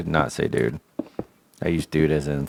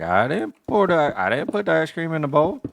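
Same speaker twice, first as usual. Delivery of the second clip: put-on high defensive kid voice; 9 semitones higher than usual. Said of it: "I'm like".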